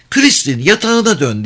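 put-on high child voice giving an order; commanding little girl. A narrator's reading. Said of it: "diye emretti küçük kız". (emreden tiz çocuk sesi)

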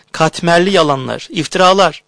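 high scornful outcry; indignant cry. Acted. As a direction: spit out the accusation. Sister rejects lies furiously.

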